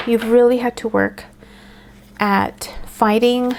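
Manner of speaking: ordinary delivery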